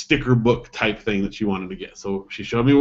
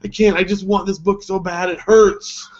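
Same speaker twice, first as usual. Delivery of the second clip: high, whiny little girl voice, mock-tearful; imitating a crying child; 6 semitones higher than usual. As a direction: play the sobbing daughter, pitched up. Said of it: "She's like".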